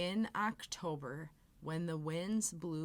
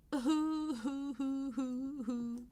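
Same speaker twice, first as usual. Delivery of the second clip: crying; 7 semitones higher than usual. Acted. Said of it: "and began to cry".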